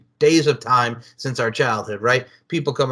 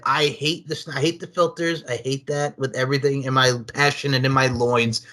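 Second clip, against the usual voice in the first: ordinary delivery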